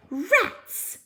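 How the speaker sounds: high little cross voice; fairy annoyance. Acted. Fairy annoyed at her wrong spell, high and cross. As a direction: grumble it as the fairy. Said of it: "said the fairy".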